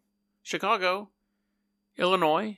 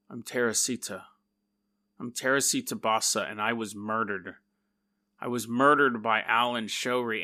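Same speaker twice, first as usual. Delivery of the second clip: lower, softer spirit voice; trance voice; 7 semitones lower, 4 dB quieter than usual. Acted. Possessed woman in a trance speaking as murdered Teresita.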